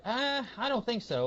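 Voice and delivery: high-pitched doubtful reaction; retold skepticism. Reader reacts to Martin's claim about his dad, skeptical. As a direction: act out balking, voice pitched well up.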